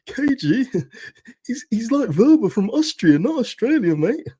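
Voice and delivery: squeaky mimic of a pedantic viewer; mocking commenter. Mimicking commenters who corrected him, in a high mocking voice.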